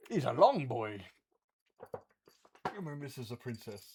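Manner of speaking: soft, quiet cooing to the cats; pet talk. Real, not acted. Streamer cooing softly to her cats Teo and princess. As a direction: hush and coo like talking to a pet. Talking to the pets.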